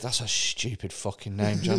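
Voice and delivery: low, soft sneering jibe; quietly mock his name. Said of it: "interviewer insulting John".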